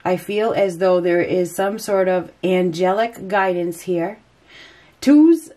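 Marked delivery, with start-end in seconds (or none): 5.0-5.5 sudden high, loud burst; excited exclamation. Real, not acted. Reader spotting twos in the cards, excited high exclamation. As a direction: pop the word out as you spot it.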